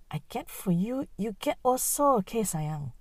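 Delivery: usual speaking voice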